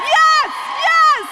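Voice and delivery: high, loud rally call; crowd hype. Real, not acted. Hyping alumni crowd.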